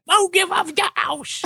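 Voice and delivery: high falsetto woman. Acted. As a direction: squeak it like a lady. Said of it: "I'll be the chick".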